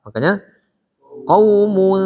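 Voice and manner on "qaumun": "qaumun" with rounded U, then flat humming N; drawn-out "qaumun"; flat nun. Shown as a demonstration. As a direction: round the lips on "mu", flatten them for the held n of "qaumun". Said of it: "nun-nya datar".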